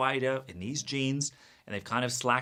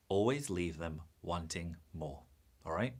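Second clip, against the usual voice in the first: solemn reveal; 6 semitones lower, 8 dB quieter than usual. Acted. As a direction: lower and softer, letting each word sink in. Solemnly reveals the golden rule.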